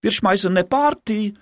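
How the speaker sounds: high, eager call; enthusiastic shout. A narrator's reading. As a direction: cheer it out together. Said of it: "riefen die anderen begeistert".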